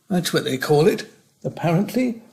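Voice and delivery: ordinary delivery, nothing marked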